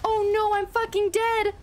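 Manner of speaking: high, real in-game panic; panicked cry. Real, not acted. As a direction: cry out high as defeat hits. Losing in a game fight.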